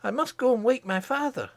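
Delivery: hurried urgent orders; Curdie, urgent. Acted. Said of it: "he cried".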